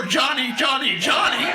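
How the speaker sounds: high laughing call of the name; joking imitation of a scream never heard; mock girlfriend calling. Girlfriend not calling for Johnny, mock high call. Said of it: "I didn't hear no".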